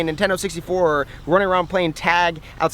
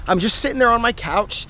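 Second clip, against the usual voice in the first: ordinary delivery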